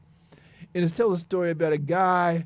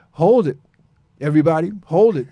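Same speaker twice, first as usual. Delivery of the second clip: loud, punchy call for attention; 10 dB louder, faster than usual. Real, not acted. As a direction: grab the room's attention with a shout. Calling out to audience before song.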